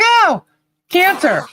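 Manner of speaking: high, breezy careless reply; loopy nonchalance. Retold or imitated. Hearing the cancer news, high breezy shrug. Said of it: "I couldn't believe it".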